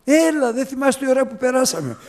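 high, loud pleading invitation; coaxing friend. Retold or imitated. Preacher voicing a friend urging him back, high and loud. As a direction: coax him back eagerly.

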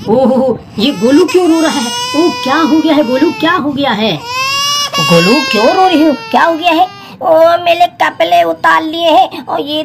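mock-sad coo asking the crying doll what happened. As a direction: soothe Golu as if he were really crying. Asks crying doll Golu.

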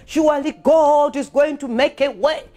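ordinary delivery

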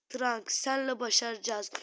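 ordinary talk, nothing marked

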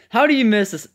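high, loud disbelieving yell; exasperated yell. Real, not acted. Exasperated at missed shot.

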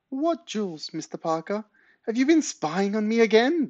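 put-on very high-pitched, indignant old lady; demanding granny. A narrator's reading. Granny turns on the spying neighbour, high indignant demand. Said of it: "demanded Granny".

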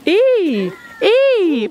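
loud, high squeals as a bird nips; startled squealing. Real, not acted. Woman squealing at a nipping swan, startled. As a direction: yelp it out, high and sudden.

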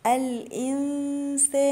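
الإنسان with a light nasal hum on the noon; light nasal ikhfa. Shown as a demonstration. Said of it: "النون الساكنة ... غنة إخفاء". (غنة إخفاء مرققة في الإنسان)

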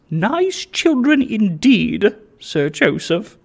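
high, slow, disgusted genteel lady; shuddering lady. Acted. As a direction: recoil in distaste, drawn out. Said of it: "said the lady with a shudder".

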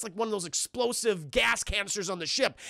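ordinary delivery

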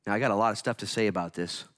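ordinary delivery

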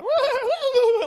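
loud, high mock neigh; whinny like horses talking to each other; imitated horse whinny. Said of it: "must have said to each other".

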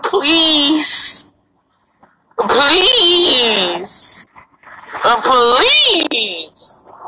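drawn-out whiny please; begging. Real, not acted. Begging viewers for a voice changer, drawn-out whine. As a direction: stretch each please out pleadingly. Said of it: "I'm about to cry".

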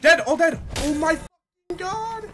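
loud, high victory yell; excited shout. Real, not acted. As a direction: yell it out in triumph. Team wipe, celebrating loudly.

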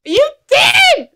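high, loud burst of astonishment; surprised shout. Real, not acted. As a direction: shout it in amazement at being proved right. Medium delighted her guess was confirmed.

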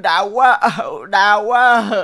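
loud, put-on groans of pain, drawn out on quá; mock pained moaning. Lazy friend fakes a stomach ache to skip cooking, loud mock moaning. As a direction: ham up the pain to dodge the chores. Faking illness to dodge work.

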